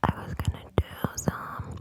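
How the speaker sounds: usual speaking voice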